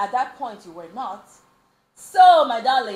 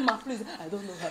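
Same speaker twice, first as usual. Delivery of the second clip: hushed plea; 2 semitones lower, 8 dB quieter, faster than usual. Retold or imitated. Retold plea disowning the friend.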